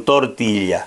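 "tortilla" with a y-like glide for ll; correct Spanish pronunciation. Shown as a demonstration. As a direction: say "tortilla" as tor-TEE-ya, falling at the end. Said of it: "se on".

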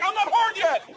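high-pitched yell; acted-out frantic stalling; panicked protest. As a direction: holler an urgent objection.

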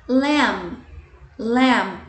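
"Lamb" said as lam with no b sound; "lamb" with a silent b. Shown as a demonstration. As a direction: say "Lamb" ending on the m. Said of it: "Cuidado com a pronúncia ... o B é mudo".

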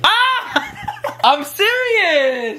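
high laughing protest; laughing yelp. Real, not acted. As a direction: squeal it out through laughter. Reacting to hair being pulled.

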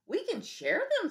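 high friendly bug voice; friendly ladybug suggesting. Acted. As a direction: offer it sweetly. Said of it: "suggested the friendly ladybug".

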